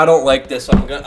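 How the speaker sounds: ordinary talk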